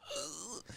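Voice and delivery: high, soft intake of breath; acted horrified gasp; mock dread gasp. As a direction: gasp as if dreading what comes next. Reacting to news of other side.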